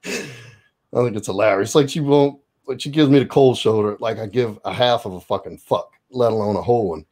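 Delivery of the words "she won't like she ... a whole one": ordinary delivery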